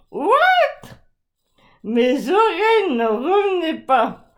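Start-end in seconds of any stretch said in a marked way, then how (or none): 0.1-0.9 high shocked yelp; shocked cry. Pretend. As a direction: squeak out in disbelief. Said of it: "je me suis dit".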